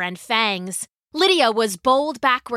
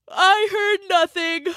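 yawning; 9 semitones higher than usual. A narrator's reading. Lydia waving Galen's worry away, yawning. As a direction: talk through a big yawn, ending in a sigh.

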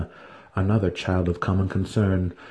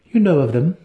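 higher, louder pointed question; sharp; 8 semitones higher, 5 dB louder than usual. A narrator's reading. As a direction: snap the question at him. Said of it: "Vienna asked sharply".

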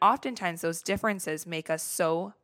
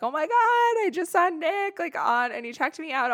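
higher, breathless gushing to friends; giddy gushing; 10 semitones higher than usual. Retold or imitated. Texting friends about Nick checking her out, higher giddy gushing. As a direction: gush about the crush, pitch up.

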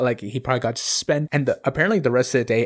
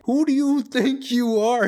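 retold indignation, voice pitched way up; imitated anger; 11 semitones higher than usual. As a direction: act out an indignant teacher in a raised pitch. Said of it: "anger in that moment's like".

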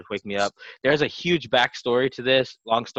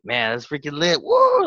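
hyped line bursting into a high whoop; retold excitement; 6 semitones higher, 6 dB louder, slower than usual. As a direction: act out party hype, ending on a loud "woo". Acts out own drunken excitement.

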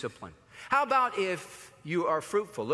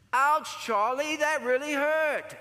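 put-on higher, louder hurt little boy voice, slowing on the last words; mock the YouTube kid crying out after the bite; Charlie bit my finger; 9 semitones higher, 4 dB louder, slower than usual. Preacher imitating the viral kid's high, loud complaint. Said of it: "it's a little YouTube joke".

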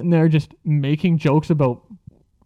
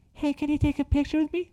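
very high, soft breathy-free falsetto MJ voice; [name] impression; 12 semitones higher, 7 dB quieter than usual. Mimicking the creepy dude asking for a photo, high MJ falsetto. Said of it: "He sounds more like".

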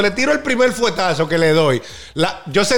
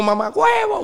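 high-pitched mocking insult; mock insult yell; 12 semitones higher, slower than usual. Imitating the rival cursing him, high mock yell. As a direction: squeal the slur like the angry guy.

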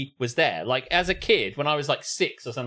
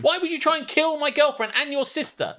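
much higher, incredulous outburst; outraged boyfriend; 12 semitones higher than usual. Retold or imitated. Boyfriend to the brother, high incredulous outburst. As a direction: play the boyfriend losing it. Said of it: "There's the boyfriend going".

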